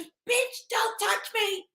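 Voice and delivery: high, shrill-free mock rant, slowing at the end; hammed-up angry passenger; mock furious shriek. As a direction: imitate her yelling don't you touch me.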